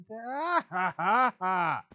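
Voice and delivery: loud high burst of laughter; real laughter. Real, not acted. Laughing at game mishap.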